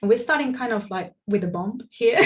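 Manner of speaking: normal talking voice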